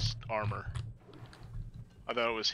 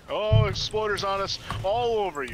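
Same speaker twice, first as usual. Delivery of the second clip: louder, higher alarmed warning; alarmed shout; 9 semitones higher, 8 dB louder than usual. Real, not acted. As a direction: shout a warning to your teammate. Warning about exploders.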